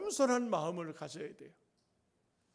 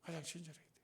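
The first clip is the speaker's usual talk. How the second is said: 가장 친절해야 돼요 repeated much softer, almost under the breath; 4 semitones lower, 13 dB quieter, much faster than usual. Shown as a demonstration. Preacher repeating his point to the congregation, much softer. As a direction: let 가장 친절해야 돼요 sink in quietly. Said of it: "repeats line for emphasis".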